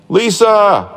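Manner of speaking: high, loud calls for Lisa; a boy yelling a name into the distance. Acted. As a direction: call out across the mountain for help. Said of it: "Jonathan started yelling it too".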